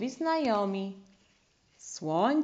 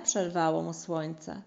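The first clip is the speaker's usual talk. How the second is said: ordinary delivery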